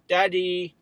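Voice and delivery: put-on child. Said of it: "he says".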